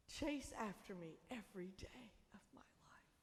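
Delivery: whispered, breathy and intimate. Acted. Preacher whispering the psalm to the congregation, hushed. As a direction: breathe the psalm line as a secret.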